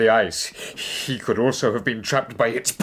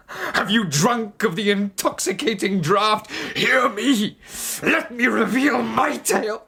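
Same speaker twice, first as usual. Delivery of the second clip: high, loud frenzied declamation; impassioned outburst; 13 semitones higher, 4 dB louder than usual. Acted. Stranger warns the captain of his madness, impassioned. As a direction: rise into wild, feverish passion.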